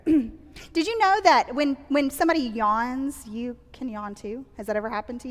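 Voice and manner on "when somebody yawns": ordinary talk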